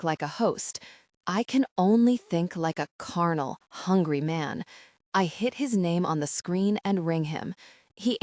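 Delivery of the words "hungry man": plain reading voice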